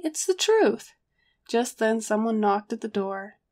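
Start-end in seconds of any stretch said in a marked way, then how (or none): 0.0-0.9 high, insistent retort; insist, refusing to back down; stubborn. A narrator's reading. Narrator defends his sales pitch to Harry, stubborn. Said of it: "I said stubbornly".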